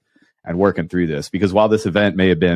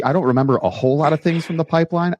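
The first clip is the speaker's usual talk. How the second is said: ordinary talk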